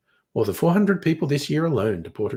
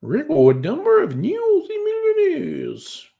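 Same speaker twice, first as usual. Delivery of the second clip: surprised; 5 dB louder than usual. Real, not acted. Reading a headline in disbelief.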